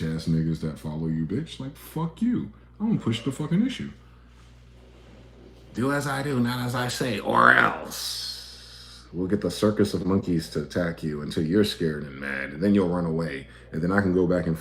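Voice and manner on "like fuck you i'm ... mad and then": defiant narcissist. Acted. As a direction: taunt them from a position of power. Voicing the narcissist's threat.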